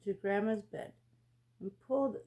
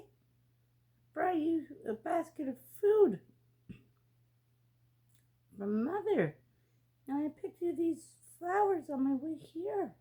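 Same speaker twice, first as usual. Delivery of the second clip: put-on little girl voice; 8 semitones higher than usual. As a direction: speak like an eager little girl.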